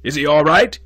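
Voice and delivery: loud, high, urgent question; excited demand. A narrator's reading. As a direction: demand it in alarm. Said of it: "he demanded excitedly".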